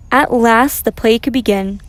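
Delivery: plain reading voice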